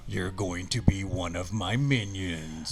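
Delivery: slow demonic threat, put-on timbre; the devil. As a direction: draw it out like a fiend claiming a victim. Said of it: "It went something like this".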